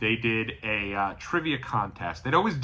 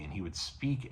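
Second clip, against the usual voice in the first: quiet menace; 4 semitones lower, 12 dB quieter than usual. Retold or imitated. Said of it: "he would talk to you maniacally".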